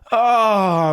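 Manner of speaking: high, loud exasperated groan; mock exasperation. Lorry driver looking at the car, loudly exasperated. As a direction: groan as if this keeps happening. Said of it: "like it's happened before".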